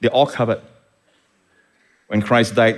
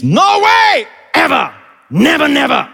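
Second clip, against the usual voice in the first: emphatic denial; 13 semitones higher, 5 dB louder than usual. Shown as a demonstration. Preacher spells out the double negative to the church, emphatic shouting. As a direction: cry out each word as an absolute no. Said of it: "no more is very emphatic".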